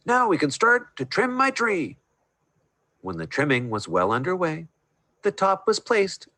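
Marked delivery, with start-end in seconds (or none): 0.0-2.0 high, happy exclaim; gleeful. A narrator's reading. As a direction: say it with glee. Master gleeful continues.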